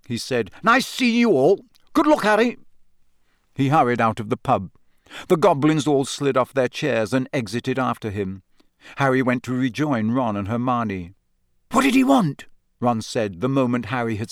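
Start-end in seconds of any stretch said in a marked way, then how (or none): 0.6-2.6 hurried cheer. A narrator's reading. Bagman hurrying off, forced cheer. 11.7-12.5 eager. A narrator's reading. Ron eagerly asking Harry.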